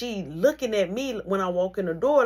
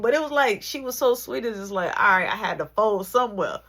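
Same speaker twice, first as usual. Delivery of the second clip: normal talking voice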